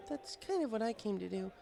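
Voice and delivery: put-on high, bookish young mare voice, caught off guard; Twilight Sparkle. Twilight puzzled the bartender knows her name, high voice. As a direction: pitch it up as a studious unicorn, puzzled. Twilight laments being unsocial.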